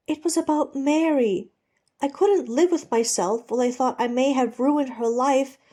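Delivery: high, loud insistence; desperate pleading. Acted. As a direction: plead urgently to be heard. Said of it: "desperate to be heard".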